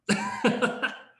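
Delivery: short high laugh; real, high-pitched laugh. Real, not acted. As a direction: laugh it off after admitting uncertainty. Laughs after saying don't know.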